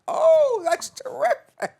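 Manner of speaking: high, loud, drawn-out gushing; mock delight. Storyteller reacting to her friend's fluffed-gland news, mock delight. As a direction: gush it slowly and loudly, high in the voice.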